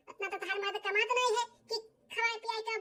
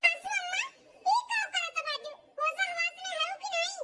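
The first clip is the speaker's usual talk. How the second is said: shocked outburst; 6 semitones higher than usual. Acted. Daughter-in-law shocked at mother-in-law.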